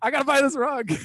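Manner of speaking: high, loud hammed-up excuse; retold awkward excuse. Bystander excusing himself from two shoppers, mock squirming. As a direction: blurt it high to escape.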